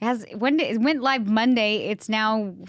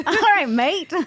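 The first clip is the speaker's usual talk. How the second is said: playful mock-Australian greeting, raised pitch; Australian-ish accent; 6 semitones higher, 4 dB louder than usual. Shown as a demonstration. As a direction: toss out an amateur Australian accent. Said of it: "like, kinda Australian-y".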